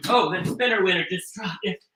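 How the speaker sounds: normal talking voice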